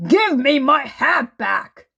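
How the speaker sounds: loud, high furious demand; snatching his hat back, yelling; angry shout. A narrator's reading. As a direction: bellow it, outraged. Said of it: "he thundered".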